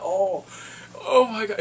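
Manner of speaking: loud, high exclamation; astonished reaction. Retold or imitated. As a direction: reenact being floored by the hit. Acting out own reaction to fight.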